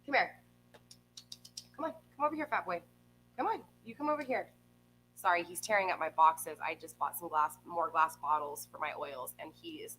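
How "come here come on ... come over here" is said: much higher coaxing voice for the cat; pet talk. Real, not acted. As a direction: coo at the cat to come over. Coaxing her cat over.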